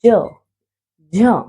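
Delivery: ज then झ, each said alone from the palate; sounding out letters. Shown as a demonstration. Teacher demonstrating palatal letters, clear and deliberate. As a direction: say ज and झ slowly and clearly. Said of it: "आपकी जीभ इस वक्त तालु पे touch होगी".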